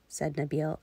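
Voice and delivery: ordinary delivery, nothing marked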